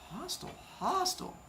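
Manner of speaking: high, soft sarcastic aside; a mocking little echo of hostile. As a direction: mutter it wryly under your breath, high. Wry comment on wife's tone.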